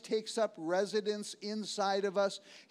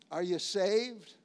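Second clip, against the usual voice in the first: usual speaking voice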